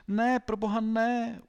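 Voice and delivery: imploring wail, high, loud and slow; Alfred. A narrator's reading. Pupil begging the headmaster to stop, imploring. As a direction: beg in a drawn-out loud cry. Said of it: "úpěnlivě žádonil Alfred".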